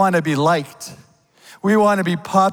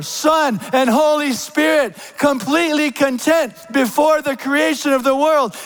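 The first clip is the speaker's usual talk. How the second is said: raised, ringing pulpit voice; full-force preaching; 8 semitones higher than usual. Acted. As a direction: proclaim it over the congregation.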